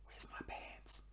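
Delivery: hushed whisper searching; frantic whisper. Retold or imitated. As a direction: whisper it so the kid won't hear. Hiding from child.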